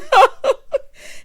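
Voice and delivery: high, loud burst of laughter; laughing. Real, not acted. Host tickled by the dead-flower bouquet, laughing. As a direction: crack up at your own joke. Laughs at her own quote.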